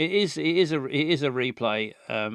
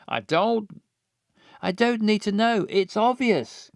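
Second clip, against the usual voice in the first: angry; 7 semitones higher than usual. Acted. Voicing jealous character.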